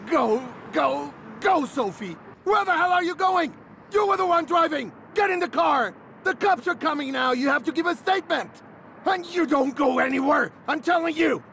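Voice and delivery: angry shouting. Acted. Instructor to Sophie as she tries to leave the crash, a high angry order. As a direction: order her back before the cops come.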